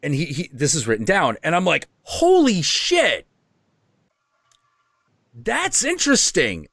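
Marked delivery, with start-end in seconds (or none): 2.0-6.7 stunned surprise. Retold or imitated. Host's own reaction to reading.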